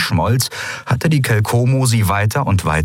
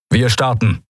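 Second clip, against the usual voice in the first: understated command to the pilot; a touch of command; giving an order; 3 semitones higher than usual. A narrator's reading. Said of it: "befahl er dem Piloten".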